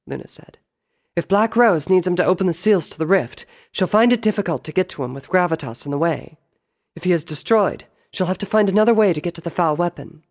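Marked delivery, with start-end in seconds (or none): 1.2-10.2 higher-pitched little sister voice; inquiring princess, raised pitch; questioning sister. Acted. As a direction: ask big brother, pitched up. Luna argues her case.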